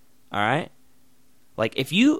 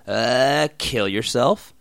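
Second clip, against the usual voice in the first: ordinary delivery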